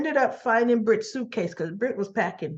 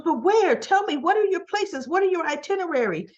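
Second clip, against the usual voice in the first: high, urgent imitation of a pressing mother; insistent questioning; 9 semitones higher than usual. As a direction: fire off the questions, pitched right up. Mother pressing daughter for trip details.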